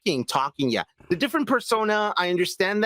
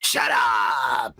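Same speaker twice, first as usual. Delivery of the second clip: angry shout; 9 semitones lower than usual. Pretend. Modok snapping back.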